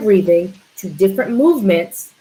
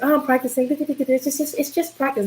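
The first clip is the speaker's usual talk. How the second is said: higher, softer brush-off; mock dismissive; 6 semitones higher, 4 dB quieter than usual. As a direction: mimic the athlete who shrugs it off. Mocking a lazy athlete.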